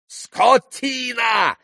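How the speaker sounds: very high, drawn-out yell; yelled insult. A narrator's reading. Pan insults the rude landlord, yelling. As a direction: bellow the insult, stretching it out. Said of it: "заорал было пан Врублевский".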